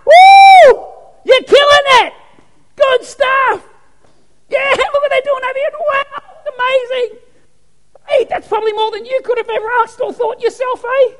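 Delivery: very high, loud excited whooping; cheering saints. Retold or imitated. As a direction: cheer the church on like fans. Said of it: "I wonder if they're going".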